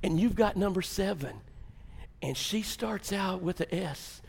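ordinary talk, nothing marked

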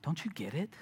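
barely voiced, tender disbelief; Jesus gently asking the frightened disciples; soft question. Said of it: "he's like".

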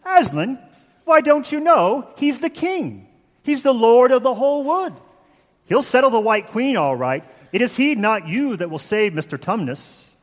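put-on high, excited Mr. Beaver in a hammy English accent; horrible English accent. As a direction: proclaim it in an exaggerated English accent. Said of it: "my horrible English accent".

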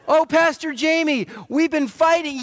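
lightly voiced complaining couple, pitched high; a hint of a moaning spouse; playful imitation of a worn-out spouse coming to the pastor; mock whiny couple.

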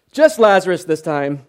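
loud joking correction to a crowd; mock calling out. Preacher plays Jesus limiting the summons to Lazarus, mock calling out. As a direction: call out the amended order for laughs. Mock scene continues after laughter.

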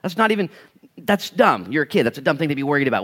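loud, high, brushing it off; a dad waving away a silly worry; dismissive dad. Retold or imitated. Dad dismissing his kid's worry, loud and high.

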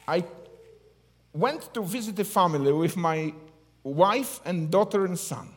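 ordinary delivery

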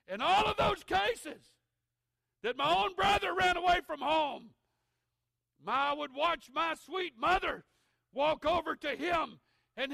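loud, high, driving sermon voice; fiery preaching. Acted. Preacher to congregation, about the runaway brother, fiery and loud. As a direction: preach it out, full force.

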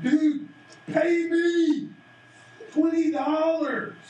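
loud, high, furious shout at the debtor; angry demand. Acted. Servant shouting at the man who owes him twenty dollars, furious. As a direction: bark it out like you're choking him for cash.

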